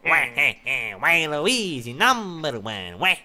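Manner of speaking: slow, drawn-out cartoon villain cackle-talk; wah-wah cartoon rival voice; Waluigi. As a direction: stretch each word with swooping pitch. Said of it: "I like, I like Waluigi".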